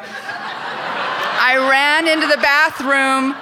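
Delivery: amused slow-motion retelling; laughing. Real, not acted. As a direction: drag it out while cracking up. Repeats line, laughing, drawn out.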